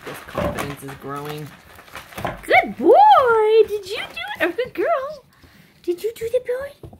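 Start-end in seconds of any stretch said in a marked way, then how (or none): 2.4-6.9 high, bright sing-song praise for the puppies; puppy praise talk. Real, not acted. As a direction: coo at the pups like a proud breeder.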